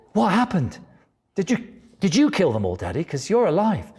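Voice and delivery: put-on high eager child's voice; a wide-eyed kid asking questions. Child quizzing father about his trip, high and eager.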